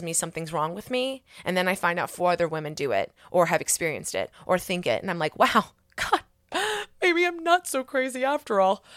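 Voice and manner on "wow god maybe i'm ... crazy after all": high, amazed exclamation with a laugh; relieved amazement. Retold or imitated. Woman finding out others share her experience, relieved amazement. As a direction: act out a relieved little revelation. Voices own relieved realisation.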